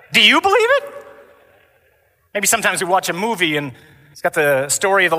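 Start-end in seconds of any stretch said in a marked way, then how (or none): 0.1-1.1 high, loud heckling challenge; yelled out. Retold or imitated. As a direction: yell the question back, pitched way up. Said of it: "we all yelled out".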